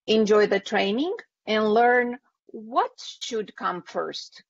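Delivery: usual speaking voice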